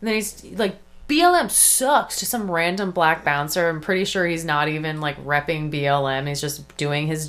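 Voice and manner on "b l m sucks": high, slow, drawn-out jeer; mock taunt. Imitating a streamer heckling a bouncer, mock taunt.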